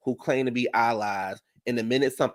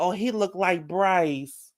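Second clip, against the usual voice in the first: mocking Nene; 4 semitones higher, slower than usual. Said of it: "screaming out the motherfucking window".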